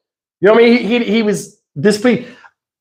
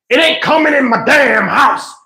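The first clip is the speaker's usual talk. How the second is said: mock angry yell; 7 semitones higher than usual. Granddad shouting at the son.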